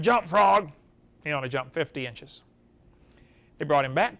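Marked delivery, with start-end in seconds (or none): 0.0-0.7 loud order at the frog; a firm, loud call; order shouted. Retold or imitated. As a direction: command it with a raised voice. Scientists command the frog again.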